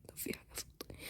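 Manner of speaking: whispered to herself. Real, not acted. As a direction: breathe it out without voice. Quiet aside while searching. (همس لنفسها)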